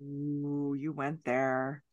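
soft, slow, drawn-out "you went there"; slow and low, savouring the daring question; teasing ooh. Real, not acted. Host teasing co-host for asking about bodies, soft and slow.

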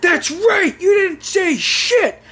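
high, drawn-out screechy rant; mock furious mother. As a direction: yell at your sons as an enraged mom. Mother keeps raging.

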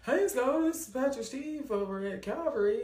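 high-pitched Southern pastor on the phone; playful Southern caller voice; Southern man impression.

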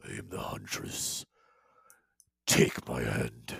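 a deep, hissing whisper luring them in; huntress voice. Acted. Monstrous huntress luring the players, deep eerie whisper. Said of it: "she says".

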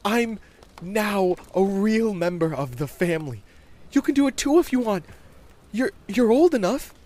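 put-on high, loud child voice; eager kid recruiting a playmate; proud, excited boy. A narrator's reading. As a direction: boast to a friend and urge them on. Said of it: "Bobby said proudly".

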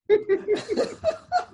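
high, delighted laughter; real laugh. Real, not acted. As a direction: laugh at your own punchline. Laughs after own punchline.

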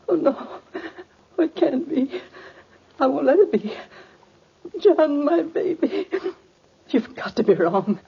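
high, sobbing disbelief; crying in grief. Acted. Mother told her boy is dead, sobbing in denial. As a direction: break down, refusing to believe it. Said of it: "forgive me for crying".

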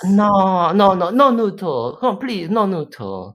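high pleading broken English. Retold or imitated. Girlfriend in a date skit refusing noodles in high broken English. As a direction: beg in simple English. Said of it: "why is her English bad".

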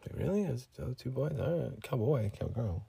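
very soft, puzzled check; hushed aside. Acted. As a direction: question them under your breath.

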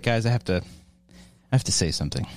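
solemn apology. Retold or imitated. Acting solemn apology to family.

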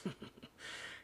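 low-volume chuckle; soft, smug little laugh; self-satisfied chuckle. A narrator's reading. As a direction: chuckle at your own joke. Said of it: "He chuckled at his own joke".

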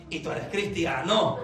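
pregunta grave que pone en duda CRISTIANO; pregunta con recelo. Shown as a demonstration. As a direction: pregunta «¿Y tú eres cristiano?» como si no lo creyeras. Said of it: "connotación completamente diferente". (duda marcada en «cristiano»)

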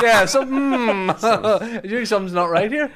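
quoting while laughing high and loud; slow laughing start, then a rush of words. Real, not acted. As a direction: giggle through the quote. Retelling the vaping women, laughing.

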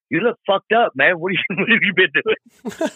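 high, laughing imitation of a stranger. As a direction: play the laughing guy who can't believe how rough you look.

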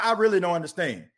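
normal talking voice

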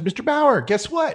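put-on high, eager little girl calling out. Neighbour's little daughter calls to Mister Bauer, high childlike voice. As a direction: pitch way up like an excited kid.

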